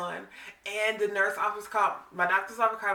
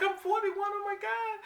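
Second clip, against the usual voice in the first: high, alarmed squeal; shocked at her own age; retold alarm; 11 semitones higher than usual. Woman realizing she is forty-one, high alarmed squeal.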